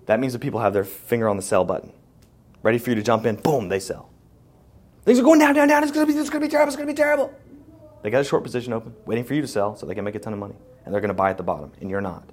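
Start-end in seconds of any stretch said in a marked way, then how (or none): 5.0-7.3 high, loud squeaky panic voice; cartoon panic, much higher and louder; mock doom. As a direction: parody a doomsayer's shrill alarm. Mocking TV doom talk.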